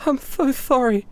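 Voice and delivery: a touch of grief, repeating; mild apologetic sorrow. Acted. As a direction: Heather apologizing to Bradley. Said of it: "she goes".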